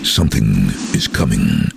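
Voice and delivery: low, ominous trailer voiceover; deep voiceover for a film trailer; movie trailer voice. Shown as a demonstration. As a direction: intone it like a blockbuster trailer. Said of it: "the first line was something".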